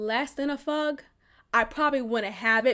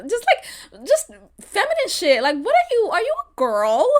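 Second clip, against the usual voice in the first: high mocking voice; 6 semitones higher, 3 dB louder than usual. Real, not acted. Mocking his feminine behaviour.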